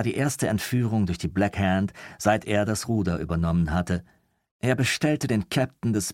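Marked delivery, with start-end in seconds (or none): none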